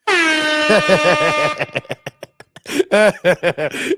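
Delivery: big burst of real laughter; loud rising laughter. Real, not acted. Big laugh at own joke.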